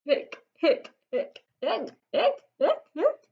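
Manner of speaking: string of mock hiccups, some high; hiccupping. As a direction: hiccup them out. Papa Bear's hiccups.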